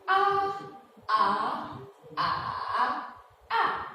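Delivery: A in the four tones in turn; four Mandarin tones on A in a row; all four tones. Shown as a demonstration. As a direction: run A through flat, rise, dip and fall. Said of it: "So we have".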